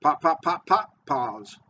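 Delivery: four rapid "pop" taps, a short gap, then "pause"; four-beat cadence demo. Shown as a demonstration. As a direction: tap out "pop" four times evenly and rest before "pause". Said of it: "So three and four".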